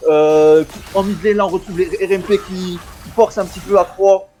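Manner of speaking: high-pitched play-by-play; esports caster. Real, not acted. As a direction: cast the action live. Live play-by-play casting.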